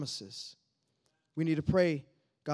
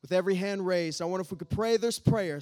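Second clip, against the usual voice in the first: ordinary delivery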